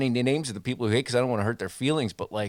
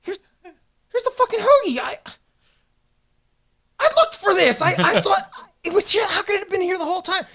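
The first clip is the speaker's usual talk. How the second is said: much higher, whimpering mock-crying voice; whiny, tearful Deco. Deco finds his lost hoagie after two weeks, whimpering. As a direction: play the baffled victim near tears.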